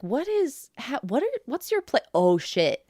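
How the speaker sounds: high-pitched mock confusion dropping into realization; puzzled reaction, replayed in a high voice. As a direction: act out baffled questions, then the penny drops. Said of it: "took me a second to figure out".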